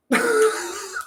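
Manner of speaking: a sudden high burst of laughter; short real laugh. Real, not acted. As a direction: laugh at the absurdity. Laugh after vagus nerve lecture.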